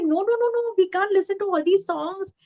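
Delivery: imitating a mother's protest, high-pitched; disapproving mother. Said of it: "And she was like".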